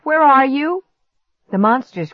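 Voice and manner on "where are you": high, loud call, rising at the end; shout. A narrator's reading. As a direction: shout for a missing brother. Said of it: "I shouted".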